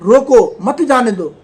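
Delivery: loud "रोको", pause, then "मत जाने दो"; "रोको" के बाद विराम. Shown as a demonstration. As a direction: break after "रोको", joining "मत" to "जाने दो". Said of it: "इसी लाइन को दूसरे तरीके से पढ़ता हूँ". ("रोको" ज़ोर से, रुककर "मत जाने दो")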